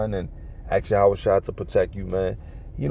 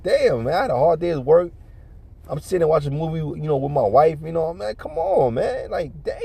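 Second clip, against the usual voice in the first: higher-pitched pleading grumble; exasperated complaint; 6 semitones higher than usual. Retold or imitated. Tired husband whose movie is spoiled, exasperated complaining. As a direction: act out being fed up, pitch jumping up.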